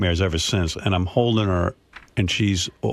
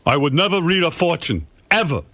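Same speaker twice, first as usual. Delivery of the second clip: mock stern father swearing off fortunes; imitating father; 8 semitones higher than usual. Father voiced again.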